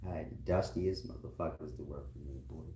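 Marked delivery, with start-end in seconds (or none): none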